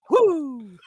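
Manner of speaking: high, loud wrestler's "woo"; Ric Flair woo. Shown as a demonstration. Showing co-host the real woo, high and loud. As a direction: whoop it high, falling away. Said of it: "Oh, my Ric Flair woo?".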